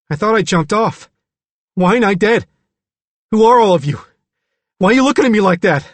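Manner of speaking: put-on high boyish voice building to loud panic; agitated, high-pitched character voice; frightened, bewildered Billy. A narrator's reading. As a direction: start explaining, then climb into alarmed questions. Billy recounts, growing panicked.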